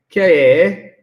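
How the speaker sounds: accidental Yorkshire accent. Real, not acted. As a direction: slip into Yorkshire on K A. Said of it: "gone Yorkshire again".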